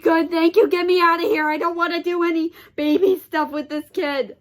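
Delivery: loud, high, exasperated relief; loud groaning plea to get out; relieved outburst. Retold or imitated. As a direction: beg to be rescued, loudly. Relief as mom arrives.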